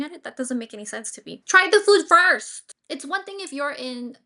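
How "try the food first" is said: slow, loud and higher, spelled out; exasperated shout. Real, not acted. Frustrated host yelling at the picky date, exasperated. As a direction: plead it at full volume. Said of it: "exasperated outburst".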